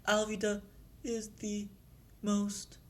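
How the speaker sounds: high, soft, halting timid voice; timid answer. Acted. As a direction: answer the boss haltingly and meekly. Trembling underling answers his boss.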